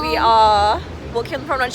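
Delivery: ordinary talk, nothing marked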